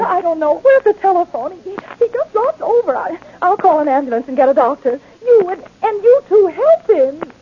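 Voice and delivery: flustered, urgent pleas for help; panicked. Acted. Librarian panics over collapsed attendant.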